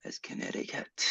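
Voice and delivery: hushed whisper to himself. Real, not acted. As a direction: whisper it as a secret aside.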